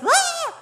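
high, loud mock scream of panic; panicked scream. Imitating daughter panicked over sunburn, high mock scream. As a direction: imitate a panicking child's shriek. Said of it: "she was in a full panic".

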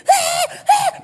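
very high squealed gasps; hammed-up squeaky intake, over and over; scared-sounding laughing gasps. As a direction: imitate helpless laughter that passes for terror. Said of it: "he thinks I'm totally scared".

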